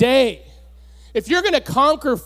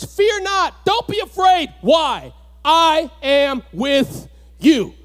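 fervent scripture reading; 4 semitones higher, slower than usual. Acted. Preacher reading God's promises to the congregation, fervently. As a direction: proclaim each verse, slowing on I am with you.

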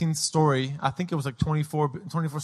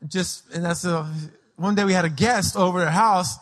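usual speaking voice

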